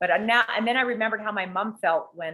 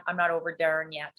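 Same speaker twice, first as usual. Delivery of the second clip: ordinary talk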